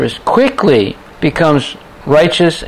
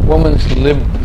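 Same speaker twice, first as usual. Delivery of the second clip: normal talking voice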